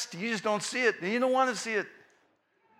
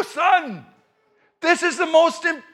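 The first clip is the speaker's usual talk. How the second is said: loud, high acted snap at a child; raised-voice scolding, cut off midword; exasperated outburst; 9 semitones higher, 6 dB louder, slower than usual. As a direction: play a dad at the end of patience. Dad snaps at third interruption.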